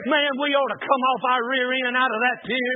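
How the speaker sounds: ordinary talk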